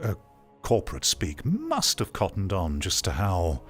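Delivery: usual speaking voice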